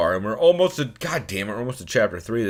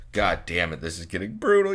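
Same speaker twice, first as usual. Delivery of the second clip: usual speaking voice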